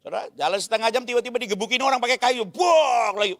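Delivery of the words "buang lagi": louder, higher shout; retold shout. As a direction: yell it out as the car is hit. Crowd beating car with wood.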